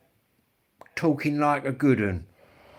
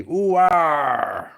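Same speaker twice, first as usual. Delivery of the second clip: mock Norfolk farmer's "Ooh, ah", higher and louder; teasing Norfolk country accent; 5 semitones higher, 6 dB louder than usual. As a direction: ham up a Norfolk yokel drawl. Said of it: "It's them Norfolk boys".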